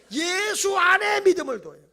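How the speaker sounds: high, loud, slow proclamation; preaching proclamation. Real, not acted. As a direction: cry it out to the congregation. (높고 크게 천천히 선포하듯)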